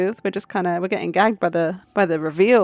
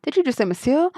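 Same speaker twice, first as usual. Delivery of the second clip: higher, startled question; surprised; 6 semitones higher than usual. Acted. Catching the prisoner speaking French, surprised. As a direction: pounce on the slip in surprise. Sudden discovery of the slip.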